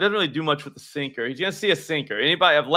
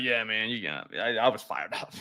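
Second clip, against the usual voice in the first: softer, slightly lowered mock confession; sheepish admission; 4 semitones lower, 6 dB quieter than usual. Showing Samuel how to admit it, soft mock admission. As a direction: own up quietly, as an example. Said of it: "just be like".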